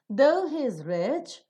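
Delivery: slow and loud, "rich" swept upward; rising tone on rich. Shown as a demonstration. Teacher reads the next example, rising on "rich". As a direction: raise "rich" and leave it unfinished. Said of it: "it will receive a rising tone".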